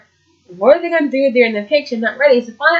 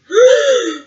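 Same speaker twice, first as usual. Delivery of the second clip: sharp high imitated gasp; a high, sudden shocked gasp; crowd gasp; 13 semitones higher than usual. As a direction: gasp like the whole crowd at once. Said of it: "you hear everyone go".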